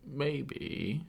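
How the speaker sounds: high, rising British-tinged maybe; slightly British. Real, not acted. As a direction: slip into a slight British lilt. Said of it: "I sound like a little British".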